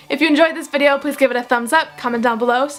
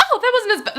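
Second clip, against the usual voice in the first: much higher, laughing through the words; laughing relief; 11 semitones higher, much faster than usual. Retold or imitated. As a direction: laugh it out in relief after the loop. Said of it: "I'm like".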